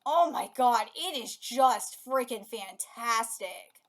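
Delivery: ordinary talk, nothing marked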